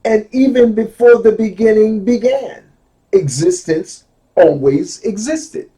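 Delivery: deliberate, forceful quotation; emphatic reading. Real, not acted. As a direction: proclaim the quoted line with weight. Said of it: "take my time and go slow".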